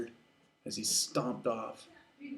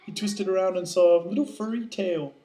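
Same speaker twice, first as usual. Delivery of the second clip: ordinary delivery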